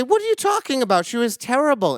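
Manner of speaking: can't believe a student is challenging him; indignant disbelief. Retold or imitated. Defending himself to the class.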